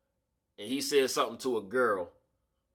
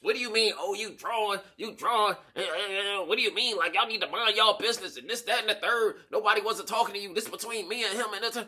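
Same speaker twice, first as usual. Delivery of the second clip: higher, lightly voiced defensive woman; lightly put-on indignant woman; 8 semitones higher than usual. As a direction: brush them off, a bit indignant.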